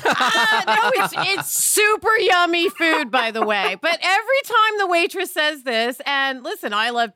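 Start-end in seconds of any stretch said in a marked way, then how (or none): 0.0-3.1 laughing through the words; real amusement at the joke, slow and laughing. Real, not acted. Laughing at the cohost's "I would walk right out", laughing.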